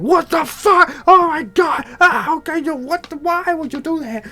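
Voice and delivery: high, loud panicked yelling; shocked scream. Acted. Vincenzo screaming after Obi-Wan slices off his arm, high panicked shout. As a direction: shriek in horror at the lost arm.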